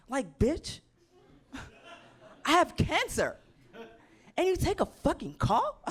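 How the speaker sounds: high, loud, laughing disbelief; outraged yell. Retold or imitated. Yelling at her dad for taking another call, outraged. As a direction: scream it in furious disbelief.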